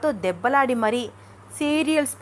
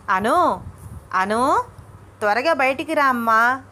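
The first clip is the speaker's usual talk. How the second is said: louder, urgent summons from outside the door; mother-in-law's insistent call; calling out loudly; 3 semitones higher, 4 dB louder, slower than usual. Acted. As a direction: call her out with raised voice. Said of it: "ఏమైంది అత్తయ్య గారు అలా అరిచారు".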